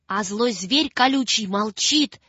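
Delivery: plain reading voice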